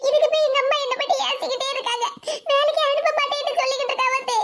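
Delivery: high, acted crying voice; tearful complaint. Crying girl telling her aunt about mother's scolding, tearful. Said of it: "ஏன் மக்கா இப்படி அழுதுகிட்டு இருக்கா".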